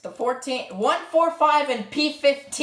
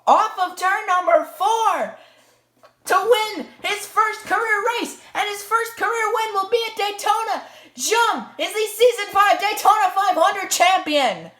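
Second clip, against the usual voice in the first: higher, triumphant finish call; motorsport commentator; 7 semitones higher than usual. Acted. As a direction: crown the champion over the line.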